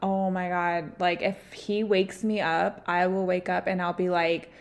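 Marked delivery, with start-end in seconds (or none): none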